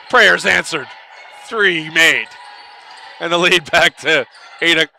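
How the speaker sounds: brisk live basketball call, rising on big plays. Acted. Commentator calling the game to fans, brisk play-by-play.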